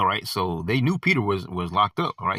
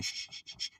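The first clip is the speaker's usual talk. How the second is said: whispered shh; demonstrated shushing; 16 dB quieter than usual. Shown as a demonstration. Preacher demonstrating the hush, whispered shushing. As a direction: hush a room. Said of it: "how people are saying".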